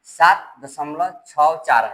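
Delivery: slow, separated "छः चार" after "दशमलव"; "सात दशमलव छः चार" spaced out word by word; digit by digit. Shown as a demonstration. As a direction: read "छः" and "चार" as single digits, slowly. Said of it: "ऐसे पढ़ा जाता है".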